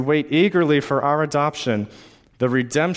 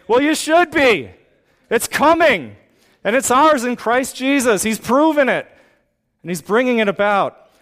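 preaching at full force; 5 semitones higher than usual. Acted. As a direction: build and lift the room toward hope.

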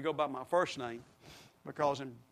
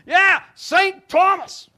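very high, loud and slow; a drawn-out, booming high announcement; triumphant shout; 14 semitones higher, 17 dB louder, much slower than usual. Retold or imitated. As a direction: proclaim the name to the crowd. Punchline shouted to the crowd.

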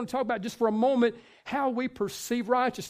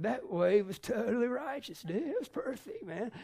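soft, laid-back surfer voice, higher at the end; surfer slang; 3 semitones higher, 7 dB quieter than usual. Retold or imitated. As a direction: talk like a chilled-out beach surfer. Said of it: "this kinda slang meaning".

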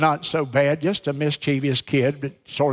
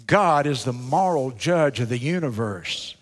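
ordinary talk

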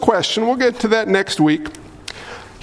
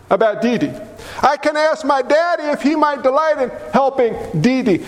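usual speaking voice

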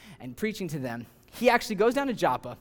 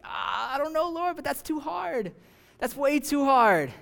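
high, pleading whine that it's too hard; whiny reluctant Jonah; 7 semitones higher than usual. Acted. Jonah whining to God that the task is too hard. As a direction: beg off like a scared prophet, stretching the last words.

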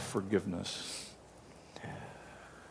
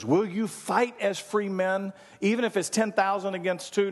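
retold rallying cry; 9 semitones higher than usual. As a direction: fling the challenge at the troops. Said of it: "he goes".

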